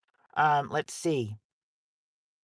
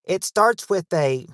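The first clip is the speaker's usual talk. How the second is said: ordinary talk, nothing marked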